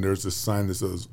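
ordinary talk

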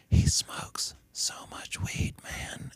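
slow unvoiced whisper; a slow, conspiratorial whisper; whispered secret. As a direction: confide it under your breath. Conspiratorial secret about Morgan.

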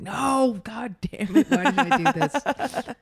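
exasperated at the catfish question; mock exasperation. Speaker mocking her own annoyance at customers asking about catfish. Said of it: "I'd be like".

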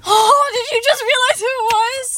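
excited teasing. Real, not acted. Laughing at friend's late realization.

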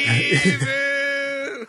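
high, drawn-out yell; shouted catchphrase. Acted. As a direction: belt out the word.

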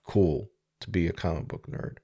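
ordinary delivery

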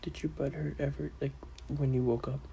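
hushed, secretive whisper. Real, not acted. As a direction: lean in and whisper it. Asking an embarrassing question quietly.

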